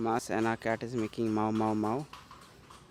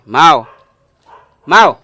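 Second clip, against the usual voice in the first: very loud shout of the name; shouting the cat's name; 4 semitones higher, 25 dB louder than usual. Real, not acted. Owner shouting his cat's name very loudly. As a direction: yell the cat's name sharply.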